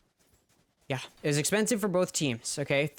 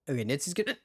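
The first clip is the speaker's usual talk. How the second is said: words broken by a real hiccup; much faster than usual. Real, not acted. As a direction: cut off by a hiccup. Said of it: "I'm hiccuping".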